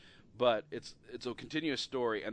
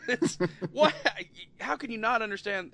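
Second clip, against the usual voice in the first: high-pitched, exasperated disbelief; incredulous protest; 8 semitones higher, 4 dB louder than usual. Acted. Fan challenging people who can't understand Monty Python, incredulous. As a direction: demand an answer in comic bafflement. Mock disbelief at accent complaint.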